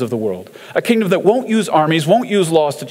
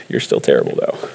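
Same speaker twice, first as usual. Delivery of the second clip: breathy low muttered jab; whispered aside; 13 semitones lower than usual. Retold or imitated. A sly jab muttered aside at the lazy crowd, whispered. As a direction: whisper it under your breath.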